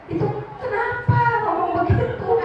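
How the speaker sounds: amused, can't keep a straight face; laughing retort. Retold or imitated. Parent laughing while scolding child for a viral phrase. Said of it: "Saya bilang".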